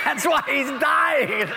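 high, drawn-out line through laughter; laughing. Real, not acted. Preacher tops his toothbrush joke for the congregation, laughing. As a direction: crack up while delivering the tag.

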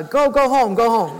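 loud, hammed-up dismissal; impatient shooing. Cobbler shooing the thankful mother home, impatient.

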